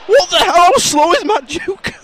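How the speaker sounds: high, loud and slow disbelief; surprised outrage. Real, not acted. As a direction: cry out in disbelief. Reacting to match on screen.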